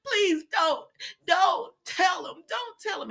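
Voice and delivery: high, drawn-out begging; hammed-up desperate plea, pitch way up; mock pleading. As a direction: beg him in a high, stretched voice. Acts out Sheila begging Deacon.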